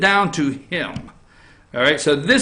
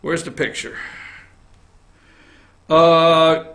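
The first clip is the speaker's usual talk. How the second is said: ordinary delivery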